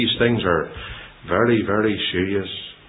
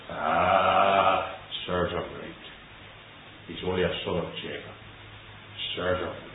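gloating heart; 5 semitones lower, 11 dB quieter than usual. Acted. Voicing gloating Edomites' inner thoughts.

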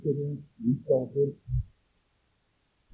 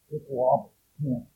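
ordinary talk

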